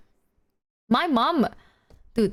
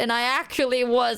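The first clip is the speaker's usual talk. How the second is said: usual speaking voice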